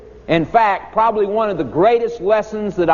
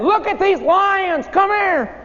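much higher, slow eager call; excited kids calling. Retold or imitated. Schoolkids urging the teacher to see lions, high and eager. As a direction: beg him to come look. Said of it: "We said".

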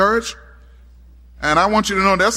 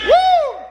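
a sudden loud high whoop; 4 dB louder than usual. Real, not acted. Preacher whooping to the congregation, loud and high.

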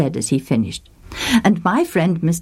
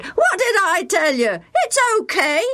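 put-on high boyish call across the pond; cocky boy calling out; shouting boy; 14 semitones higher than usual. A narrator's reading. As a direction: shout back in triumph. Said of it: "he shouted".

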